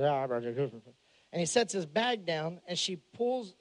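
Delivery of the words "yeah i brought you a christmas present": trucker voice. Retold or imitated. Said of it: "He goes".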